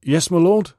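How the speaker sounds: a servant answering his lord through fog; answering the master. A narrator's reading.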